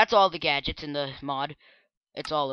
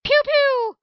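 squeaky shouted laser blast; loud laser pew; 9 dB louder than usual. Pretend. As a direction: fire it like a toy ray gun. Loud high laser noise.

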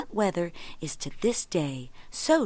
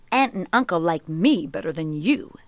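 exclamation; 5 dB louder than usual. A narrator's reading. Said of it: "exclaimed the Sun".

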